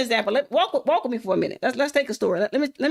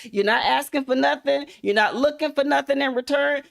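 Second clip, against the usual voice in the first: ordinary talk, nothing marked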